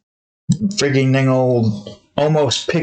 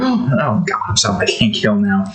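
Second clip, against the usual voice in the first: normal talking voice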